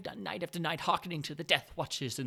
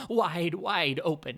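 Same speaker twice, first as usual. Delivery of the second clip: horrified emphasis; 7 dB louder, slower than usual. A narrator's reading. Narrator horrified at the eye.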